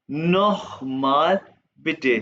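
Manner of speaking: नोखमाल with a throaty kh, very slow; German kh sound. Shown as a demonstration. Teacher demonstrates the kh sound in नोखमाल. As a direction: stretch नोखमाल slowly, throaty kh. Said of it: "खे की आवाज आएगा".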